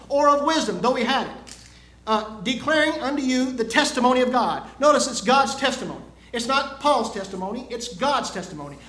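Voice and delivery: loud, emphatic sermon delivery; preaching. Real, not acted. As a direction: drive the point home to a congregation. Preaches from scripture to congregation.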